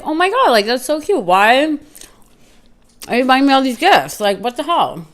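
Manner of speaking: high, loud, gushing over the flowers; excited gushing. Retold or imitated. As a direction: squeal it like you can't believe the gifts. Gushing over surprise flowers from date.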